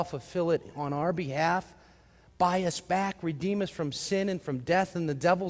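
ordinary delivery

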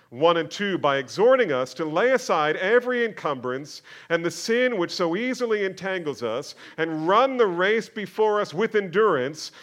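loud, high, slowed preaching build; fiery pulpit delivery, loud and high; full-force preaching. Acted.

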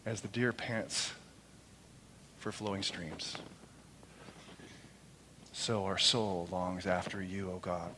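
slow, soft, drawn-out psalm reading; quiet devotional recitation; reverent scripture reading. Acted. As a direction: let each phrase breathe, hushed. Reading Psalm forty-two reverently.

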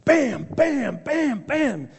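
loud, high, punchy bam sounds; Hulk smash noises. Retold or imitated. Hulk smashing Loki into the floor, loud smashing bams. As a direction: slam the words like blows. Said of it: "Hulk just picks him up and goes".

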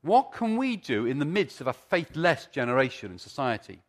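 usual speaking voice

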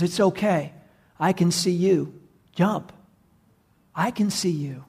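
gentle, spaced-out reassurance; reassuring father. Acted.

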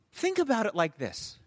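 ordinary talk

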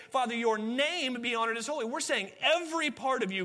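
fervent pulpit delivery; full-force preaching. Acted. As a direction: preach it out to the congregation. Forceful preaching, louder and higher.

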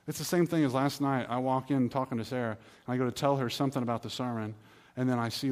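usual speaking voice

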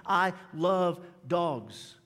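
ordinary delivery

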